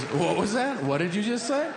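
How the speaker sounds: high, disbelieving double-take; incredulous surgeon. Retold or imitated. Surgeon doubting the patient's answer, high and disbelieving. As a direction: ask it like you misheard.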